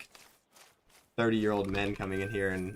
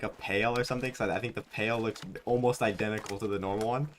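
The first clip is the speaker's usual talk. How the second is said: ordinary talk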